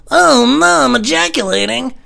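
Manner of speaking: hammed-up cry of dismay; mock wailing. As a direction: wail helplessly, dragging the words out. Helpless mock wail at climax.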